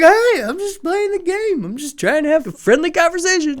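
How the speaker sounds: high faux-innocent kid voice; mock innocent catcher. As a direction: play dumb sweetly in a high voice. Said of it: "He's like".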